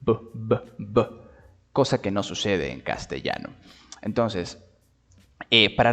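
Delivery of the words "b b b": "B, b, b" with a strong stop b; hard b. Shown as a demonstration. Teacher drilling the strong Portuguese b. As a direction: hit the b hard. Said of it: "esta B fuerte".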